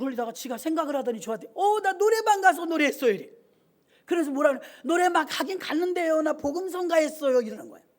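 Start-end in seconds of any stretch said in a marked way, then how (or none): 1.5-3.1 put-on high, loud little girl's voice; girl's confession. Girl confesses in childlike voice. 4.8-7.4 higher, louder schoolgirl voice; girl's excuse. Retold or imitated.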